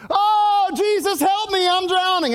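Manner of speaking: high, loud drowning wail; panicked cry for help. Acted. Preacher plays sinking Peter calling to Jesus, panicked cry for help.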